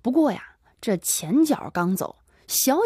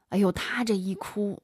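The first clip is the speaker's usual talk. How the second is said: plain reading voice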